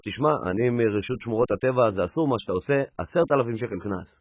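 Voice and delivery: low official fine; stern inspector low. Acted. Inspector fines Bedouin.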